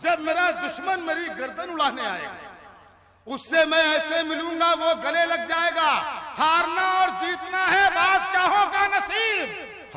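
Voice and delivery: high, loud mushaira recital; declaimed poetry. Acted. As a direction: ring it out to the crowd. Recites verse to audience. (बुलंद आवाज़ में शायरी)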